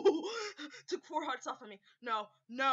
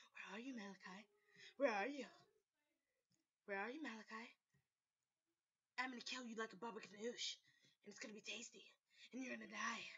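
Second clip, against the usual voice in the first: low, much softer creepy threat, ending whispered; menacing murmur; 7 semitones lower, 17 dB quieter than usual. Acted. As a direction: taunt the hidden prey in a creepy hush. Hunting Malachi, menacing threat.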